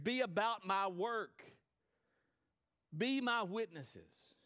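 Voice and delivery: thundering pulpit proclamation; full-force preaching. Acted. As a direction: proclaim each phrase to the congregation.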